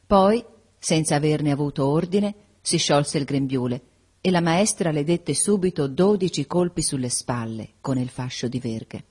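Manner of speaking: plain reading voice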